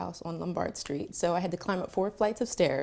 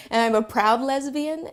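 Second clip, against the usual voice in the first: slow, louder and higher, full of pride; proud declaration; 5 semitones higher, 7 dB louder, slower than usual. Retold or imitated. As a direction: declare it to the whole room. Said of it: "say it with pride and conviction".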